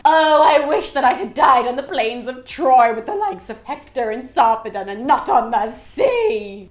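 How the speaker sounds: loud, hammed-up wail to the heavens; mock crying scream. Aeneas calls up to the sky, mock crying lament. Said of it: "he's crying and he's screaming".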